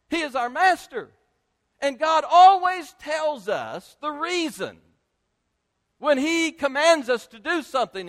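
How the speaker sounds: high, loud pulpit delivery; forceful preaching. Real, not acted. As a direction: preach it with ringing conviction.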